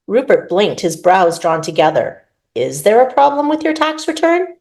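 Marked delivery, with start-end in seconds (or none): 2.5-4.5 puzzled, higher echo of the question; confused echo. Acted. Rupert echoing Laurent's question, puzzled and higher. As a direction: repeat it back baffled.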